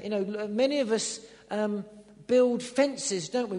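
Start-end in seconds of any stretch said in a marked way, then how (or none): none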